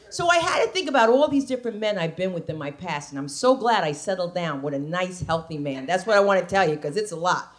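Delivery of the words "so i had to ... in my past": ordinary talk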